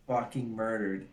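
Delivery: ordinary delivery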